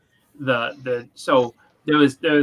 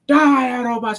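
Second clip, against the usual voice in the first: high, loud villain snarl; recalled Transformers villain yelling at the Autobots; movie villain battle cry; 8 semitones higher, 5 dB louder than usual. Retold or imitated. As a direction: bellow it like a cartoon villain attacking.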